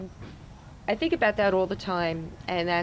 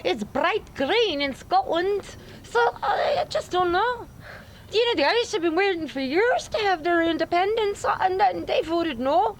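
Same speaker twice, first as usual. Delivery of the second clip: Scottish accent; 7 semitones higher than usual. Shown as a demonstration. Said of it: "too close to Scottish for me".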